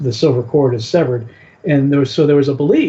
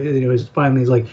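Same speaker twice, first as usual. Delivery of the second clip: normal talking voice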